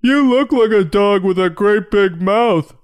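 very high, squawking pig accuser; Iris. Acted. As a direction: call her out in a shrieky put-on voice. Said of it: "says Iris".

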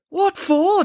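high-pitched, indignant outburst; indignant demand. A narrator's reading. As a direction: demand an answer, voice shooting up. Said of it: "demanded Bernie".

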